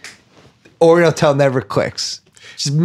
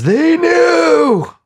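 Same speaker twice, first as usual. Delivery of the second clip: mock award shout; 14 semitones higher than usual. Naming their overacting award.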